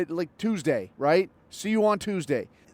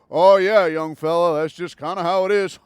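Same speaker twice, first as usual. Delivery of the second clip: mock boomer; 5 dB louder than usual. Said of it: "and they're like".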